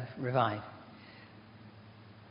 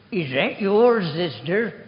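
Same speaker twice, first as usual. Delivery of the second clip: put-on an old deaf man calling across the ward, louder; mock loud call; 4 semitones higher, 10 dB louder, slower than usual. Old deaf patient calling across the ward to the nurse, loud. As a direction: call out loudly to the nurse, mildly hammed.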